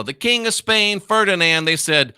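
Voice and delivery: ordinary delivery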